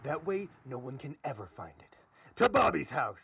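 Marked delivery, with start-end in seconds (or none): 2.4-3.2 loud heroic send-off; rally the gang with a louder call. Acted. Sheriff sending the gang to the boy's house, rallying cry.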